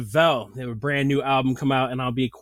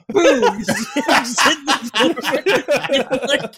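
slow, loud, much higher laughter; cracking up, barely getting the words out; laughing hard; 12 semitones higher, 7 dB louder, slower than usual. Real, not acted. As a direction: lose it laughing.

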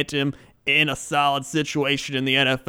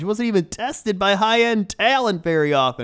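draft analyst read; 7 semitones higher, 3 dB louder than usual. Acted. Host reading a draft profile to his cohost, analyst voice. Reads scouting report aloud.

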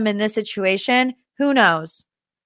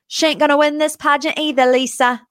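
James Kennedy line; 6 semitones higher than usual. Retold or imitated. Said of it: "Let me try it again".

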